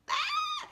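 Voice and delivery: short high, loud shriek; mock scream. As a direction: cry out sharply at a horrible sight. Mock horror at husband's creamer.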